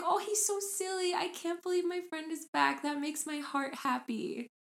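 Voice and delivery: put-on high-pitched innocent child voice; sweet, high little-boy delight. Little boy delighted his bird friend is back, high child voice. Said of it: "he was like".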